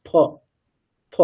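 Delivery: ফ said with both lips fully touching; full pha demo. Shown as a demonstration. Teacher showing the lips closing fully for ফ. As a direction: press the lips together for ফ. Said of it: "পূর্ণ ফকার যখন বলছি দেখুন আমাদের দুটো ওষ্ঠ পুরোপুরি touch করছে".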